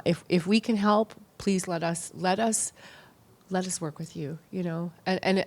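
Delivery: normal talking voice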